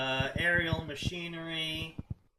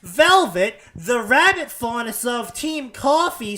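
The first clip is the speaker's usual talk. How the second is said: loud, higher mock announcement; mocking the author; 7 semitones higher, 13 dB louder than usual. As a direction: lampoon the clunky intro at full volume. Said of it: "Sitting down and being like".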